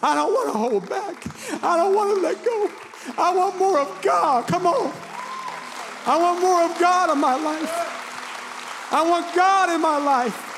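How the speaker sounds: high, strained cry to God; real tearful, impassioned plea, drawn out; tearful preaching. Real, not acted. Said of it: "Excuse me for crying".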